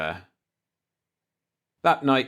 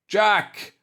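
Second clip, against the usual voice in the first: loud high holler of a name; 9 semitones higher, 3 dB louder than usual. A narrator's reading. Old man hollering for Jack upstairs. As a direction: call up the stairs urgently. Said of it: "I hollered".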